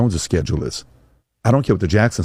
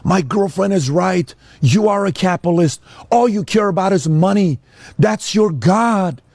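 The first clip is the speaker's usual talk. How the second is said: put-on high, slow, accusing teen voice; a son pitched high and bitter, storming off; angry son; 10 semitones higher than usual. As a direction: throw it at your father like a resentful kid. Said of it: "they walk away and say".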